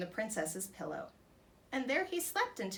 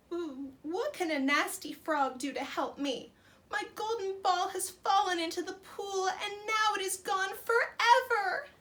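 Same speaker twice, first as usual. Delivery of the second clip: higher, louder tearful whine; crying princess; 9 semitones higher, 5 dB louder than usual. Acted. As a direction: sob out the words. Princess cries to frog.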